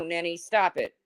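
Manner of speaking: ordinary delivery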